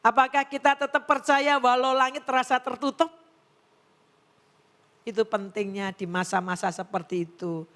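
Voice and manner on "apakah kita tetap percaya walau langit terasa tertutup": preaching appeal. Acted. Preacher's rhetorical challenge.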